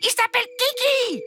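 put-on high whiny child voice demanding; a spoilt boy stamping for what he wants; spoiled little prince. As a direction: petit prince capricieux, voix aiguë. Ange corrects queen.

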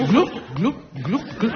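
gloup gloup imité, qui remonte; bruit de bulles. Pretend. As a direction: imiter des bulles sous l'eau. Le train plonge dans le lac. (onomatopée de bulles qui montent)

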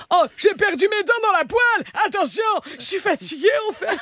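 high, quavering mock old lady; old woman. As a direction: play a toothless old granny. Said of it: "fais la vieille". (vieille dame édentée et fatiguée, voix haute)

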